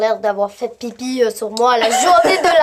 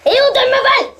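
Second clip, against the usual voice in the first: words breaking into a laugh; 14 semitones higher, 3 dB louder than usual. Real, not acted. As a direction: burst out laughing mid-sentence. Fou rire pendant le jeu. (en éclatant de rire)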